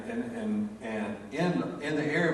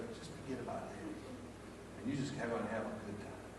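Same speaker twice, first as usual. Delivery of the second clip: gentle reassurance; 2 semitones lower, 14 dB quieter than usual. Retold or imitated. Comforting granddaughter at game.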